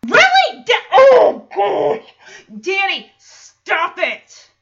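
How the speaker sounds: loud, high exasperated cry; half-laughing shout of disbelief; exasperated outburst. Real, not acted. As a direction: blurt it out in disbelief. Reacting to Danny's line.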